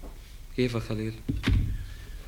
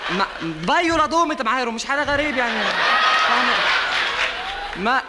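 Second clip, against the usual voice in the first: excited ranting; 7 semitones higher than usual. Acted. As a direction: rant about the tank, voice pitched up. Ranting about water tank, high. (نقّ متحمس بصوت عالٍ ومرتفع)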